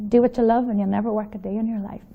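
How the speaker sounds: normal talking voice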